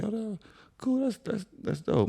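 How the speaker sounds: soft, very high voice for the wife; imitating wife. Husband voicing his wife's reply about a friendly fan, high soft imitation. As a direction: lightly mimic her approval up high.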